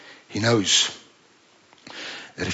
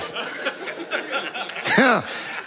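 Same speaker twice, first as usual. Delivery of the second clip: a long fading laugh, softer at the end; 12 semitones higher, 5 dB quieter than usual. Real, not acted. Preacher laughing after teasing the bassist. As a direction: let the laugh roll out and die away.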